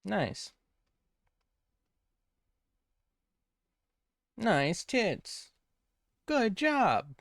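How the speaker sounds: high, hyped-up announcer calls; video game announcer. Acted. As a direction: shout it like a cheesy game announcer. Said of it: "me when I'm a problematic video game announcer".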